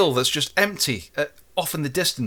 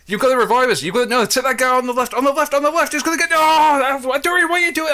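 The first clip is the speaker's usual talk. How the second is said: high, loud orders climbing into a yell; frantic urging; 10 semitones higher, 4 dB louder than usual. Retold or imitated. Downed teammates to the last survivor, frantic urging. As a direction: build from urgent to panicked.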